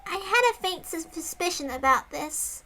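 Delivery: put-on high, small girl's voice; Sadako voice. Reader voicing Sadako, a small girl. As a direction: find her girlish voice again. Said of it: "what was my voice for Sadako?".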